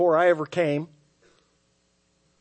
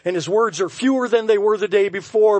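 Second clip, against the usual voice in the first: ordinary talk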